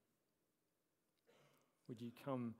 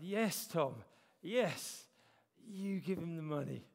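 loud, higher booming divine voice; voice of God; 5 semitones higher, 9 dB louder, slower than usual. Retold or imitated. God booming back at the pastor, loud and insistent. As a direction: boom it out like God answering a prayer. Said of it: "And he said".

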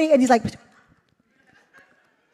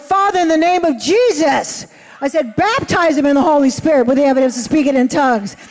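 raised, commanding prayer voice; bold prayer. Retold or imitated. Preacher at the altar praying boldly over the doctor. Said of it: "my charismatic side came in to me".

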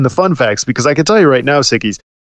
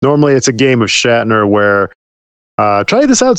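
normal talking voice

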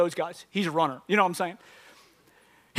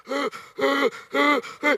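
heavy rhythmic gasps; imitated panting. Comedian contrasting himself with easy runner, exaggerated panting. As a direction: act out gasping on a treadmill. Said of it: "And here I am, like".

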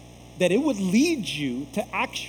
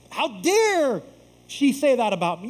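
higher and louder, hammed-up offense; mock offended; 5 semitones higher, 5 dB louder than usual. Pastor mimicking a churchgoer's hurt pride, in mock offense. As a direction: play the wounded ego. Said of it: "I'm talking like that".

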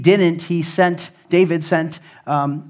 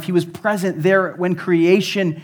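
building preaching; 3 semitones higher, 5 dB louder than usual. Acted. Preaching at full force.

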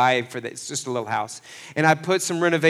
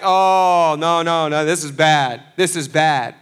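louder, a mock panicked no no; panicked crowd; 6 dB louder than usual. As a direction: play the scared crowd hearing about giants. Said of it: "Everybody's like".

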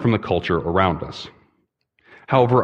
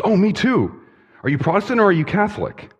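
much higher, quick and delighted; eager old man; 11 semitones higher than usual. Acted. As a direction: rush the question out gleefully. Joke: eager old man quizzes.

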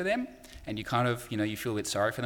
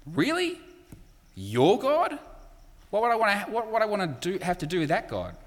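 incredulous scoff, higher and rising on Really; mock the idea of worshipping that God; scoffing nations; 9 semitones higher than usual. The nations laughing at Israel's call, mocking disbelief. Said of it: "and just kinda laugh".

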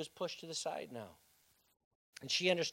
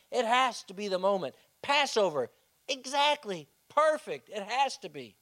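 excited hope; 6 semitones higher, 8 dB louder than usual. Acted. Mary's hopeful thoughts voiced.

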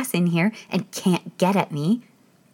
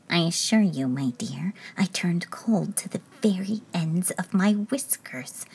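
frightened whisper. Acted. King whispering to the Queen, frightened whisper. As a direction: hush it, shaken. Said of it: "talking together in a frightened whisper".

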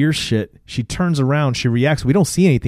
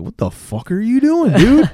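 high mock outrage, louder at the end; outraged demand; 11 semitones higher, 5 dB louder than usual. Father confronting a man by his daughter, mock outraged. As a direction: confront him, pitched up, punching the last word.

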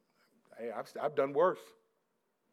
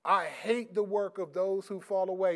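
full-force preaching; 5 semitones higher, 12 dB louder than usual. Real, not acted. Preacher reading the Psalms to his congregation, full-force preaching. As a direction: proclaim the verse to the whole church.

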